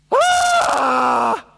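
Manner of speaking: loud high startled "Ah!"; frightened shout. Acted. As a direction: yelp as if you've seen a ghost. Mates see the ghost.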